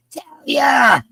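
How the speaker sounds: high, loud cry of a name; calling out. Real, not acted. Mother spots her daughter in chat, calling out high. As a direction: holler the name, stretching the end.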